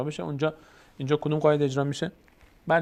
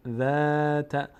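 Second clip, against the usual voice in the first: slow, drawn-out tajwid recitation; Quran recitation; 3 semitones higher, much slower than usual. Shown as a demonstration. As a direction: chant each word slowly. Recites verse.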